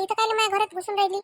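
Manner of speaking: normal talking voice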